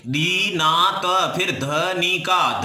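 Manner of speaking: दीनात and Dhanika stretched syllable by syllable; each syllable of दीनात and Dhanika drawn out separately; slow syllable breakdown. Shown as a demonstration. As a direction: sound out दीनात, then Dhanika, very slowly. Teacher sounds out both words by syllables.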